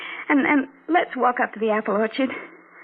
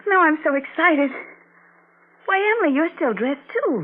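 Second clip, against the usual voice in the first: put-on bright girlish excitement; excited, higher; 9 semitones higher than usual. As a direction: bubble over, pitched up.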